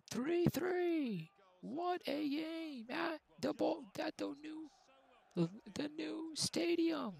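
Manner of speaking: commentator calling the match; live play-by-play. Acted. Gamer calling his own match like a TV commentator, live play-by-play. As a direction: call it like a TV football commentator.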